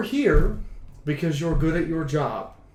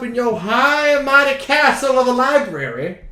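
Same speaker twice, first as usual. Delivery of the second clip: mock grand taunt; 10 semitones higher, 9 dB louder than usual. Mock jab at the player.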